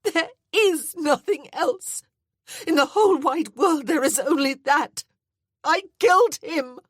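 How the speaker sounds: high, loud, slowed crying voice; anguished keening; despairing wail. A narrator's reading. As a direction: insist through sobs that nothing else matters. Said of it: "She hardly recognized her own voice".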